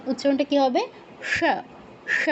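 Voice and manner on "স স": "স" as retroflex ṣa; isolated ṣa for "স", tongue tip on the roof; retroflex sibilant. Shown as a demonstration. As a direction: say "স" as sha with the tongue curled back. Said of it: "জিহ্বাটা fold হয়ে মূর্ধায় touch করে".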